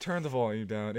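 ordinary talk

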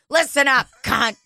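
tough switch. Retold or imitated. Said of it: "And I'd be like".

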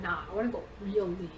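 ordinary talk

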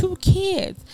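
high, suspicious tallying of clues; pointed pondering. Acted. Co-host adding up the gossip, pointedly pondering. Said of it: "it does make you think".

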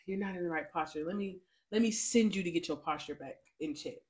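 God speaking gently and low; softly voiced God. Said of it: "and God is just like".

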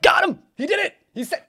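high, loud imitation of an excited chat; mock gleeful jeering. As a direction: crow like a crowd catching someone out. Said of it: "all of chat's just like".

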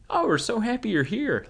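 put-on high, sweet welcoming old voice; friendly old lady. Cheerful elderly volunteer greeting voters, high sweet voice. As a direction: gush it like a delighted grandma. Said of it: "these friendly old faces, just like".

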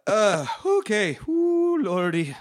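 breathless, wheezy laughter; out of breath from laughing. Real, not acted. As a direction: gasp through the words. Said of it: "I can't catch my breath".

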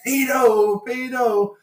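high, loud mock screams; imitated screaming. Train carriage turning on a friend, imitated screaming. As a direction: yell it like an accusing carriage. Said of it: "started pointing at Owen and screaming".

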